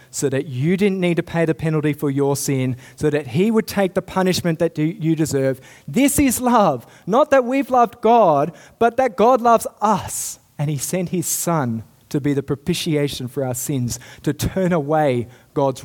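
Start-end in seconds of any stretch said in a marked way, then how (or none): 5.9-10.4 higher and louder, ringing out; impassioned proclamation. Acted. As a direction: proclaim it to the whole church. Preacher proclaims climactic truth.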